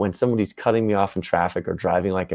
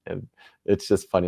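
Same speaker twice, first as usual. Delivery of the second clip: ordinary talk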